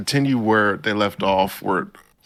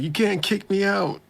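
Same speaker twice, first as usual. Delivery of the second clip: high mock protest; 12 semitones higher than usual. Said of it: "and he's like".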